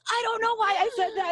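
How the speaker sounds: high-pitched embarrassed protest; high, far from casual; mortified wail. Retold or imitated. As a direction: wail it, cringing at yourself. Acting out mortified reply to husband.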